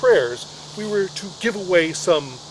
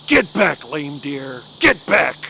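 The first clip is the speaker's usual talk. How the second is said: commanding shout; 3 semitones lower, 3 dB louder, slower than usual. Retold or imitated. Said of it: "throws her naked body in front of him and says".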